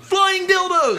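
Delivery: very high, loud heckling yell; drunken yell. Retold or imitated. Yelling at every act on stage, a loud drunken yell. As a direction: scream it at the stage. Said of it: "I just yelled".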